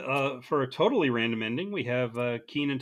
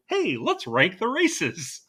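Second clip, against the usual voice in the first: perky higher-pitched title, drawn out slowly; 5 semitones higher, 4 dB louder, much slower than usual. Retold or imitated. Host quoting a coach's awful text chain name, perky. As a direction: chirp it slowly like a fun game title. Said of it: "how perky that the title of that text sheet is".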